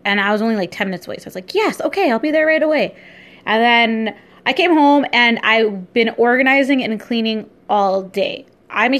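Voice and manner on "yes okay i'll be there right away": retold eager reply, pitched high; eager acceptance. Retelling her reply to the eyebrow person, high and eager. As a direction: jump at the offer in a higher voice.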